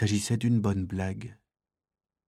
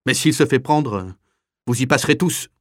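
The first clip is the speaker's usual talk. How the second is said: raised, higher threatening voice; threatening warning; 4 semitones higher, 6 dB louder than usual. Acted. Kapo giving in to determined prisoners, loud threat. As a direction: warn them all loudly.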